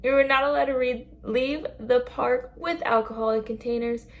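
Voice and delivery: mock Epcot exit announcement; park announcement spiel. Shown as a demonstration. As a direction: read it like the closing park announcement over the speakers. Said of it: "So like that, but smoother".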